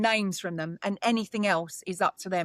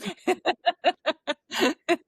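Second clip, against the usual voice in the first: a real burst of laughter, pitched up; bright laugh; 9 semitones higher, 3 dB louder than usual. Real, not acted. The medium laughs at her own defiance of the boards, a real high laugh.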